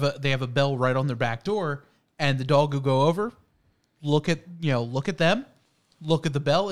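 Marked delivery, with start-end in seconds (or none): none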